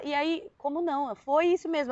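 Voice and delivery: ordinary talk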